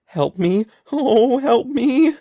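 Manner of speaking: high, loud, drawn-out terrified shriek; panicked child shrieking. A narrator's reading. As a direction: scream for help in terror. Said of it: "he shrieked".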